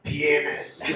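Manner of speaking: "Penis" said low and deadpan, mock Russian; flat sour mock Russian "Penis"; deadpan fake Russian accent. Shown as a demonstration. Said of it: "this is how you say the word penis in Russian".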